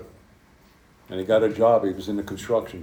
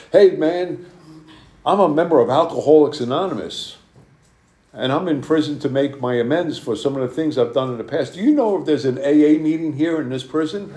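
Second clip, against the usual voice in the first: put-on higher and louder, eager young voice; excited young inmate, higher and louder; hyped up kid; 5 semitones higher, 6 dB louder than usual. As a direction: bubble over like a keen new convert. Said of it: "he was pretty hyped up".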